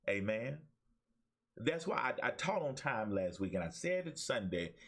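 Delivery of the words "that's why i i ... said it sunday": usual speaking voice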